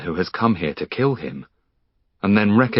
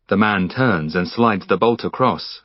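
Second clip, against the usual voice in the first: ordinary delivery, nothing marked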